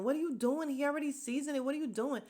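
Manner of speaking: high-pitched mild mock yell; imitated yelling. Housemates protesting over the seasoned food, imitated yelling. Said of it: "all of them are yelling".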